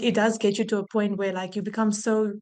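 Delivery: ordinary talk, nothing marked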